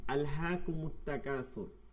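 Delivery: আলহাকুমুত তাকাসুর drawn out slowly, joined as in the verse; slow Quranic reading. Shown as a demonstration. Teacher reads the example verse to students, slow and careful. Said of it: "যেমন এখন উদাহরণ দেখেন".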